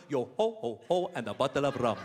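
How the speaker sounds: modern-day Somali pirate impression; Somali pirate accent. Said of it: "That is how modern-day pirate talks".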